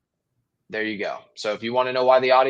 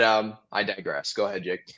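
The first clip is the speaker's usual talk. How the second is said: normal talking voice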